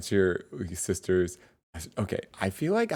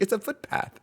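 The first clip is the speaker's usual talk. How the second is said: much higher, hammed-up Australian accent; mock Australian accent; 3 dB louder than usual. Imitating Nat's Australian "footpath", much higher. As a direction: play the Aussie friend insisting, in a bad Australian accent. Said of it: "I can't do the Australia accent".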